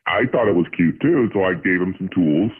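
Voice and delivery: ordinary talk, nothing marked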